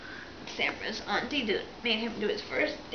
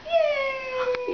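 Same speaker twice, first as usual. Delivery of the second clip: excited cheer; 4 dB louder than usual. Real, not acted. Cheering baby.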